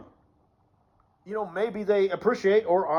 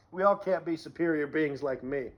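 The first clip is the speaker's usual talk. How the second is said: ordinary talk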